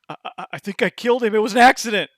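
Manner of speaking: quick, stammering, a touch of panic. Acted. Husband confessing to the group over the body, mild stammering panic. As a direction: stutter out the confession. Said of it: "he looks up and says".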